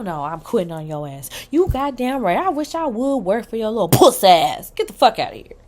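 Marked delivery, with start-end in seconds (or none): 1.5-5.6 angry rant. Real, not acted. Ranting at an imagined boss.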